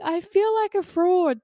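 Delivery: very high, loud retold wail; mock crying meltdown. Woman confessing to partner, mock meltdown wail. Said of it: "freaking cry meltdown moment".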